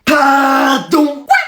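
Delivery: drawn-out, high-pitched outraged disbelief; stunned disbelief. Acted. Pupils hear everyone passed, stunned disbelief. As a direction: stretch each word in high, theatrical shock. Class stunned by good marks.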